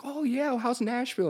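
friendly support agent. Retold or imitated. Philippines tech support chatting, imitated friendly. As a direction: mimic a chatty helpdesk voice. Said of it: "They're like".

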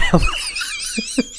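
high squealing laugh; laughing hard at the story; real laugh. Real, not acted. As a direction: burst out laughing. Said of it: "It's so fucking funny".

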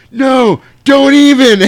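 high loud protest; panicked shout. Retold or imitated. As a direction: shriek in alarm. Corey Haim protests.

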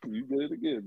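laughing softly through the words; teasing laugh. Real, not acted. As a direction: giggle while you tease.

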